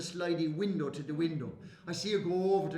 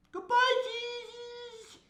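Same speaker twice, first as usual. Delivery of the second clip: high, slow sing-song farewell; drawn-out goodbye; much slower than usual. Acted. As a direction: stretch the goodbye up to the sky.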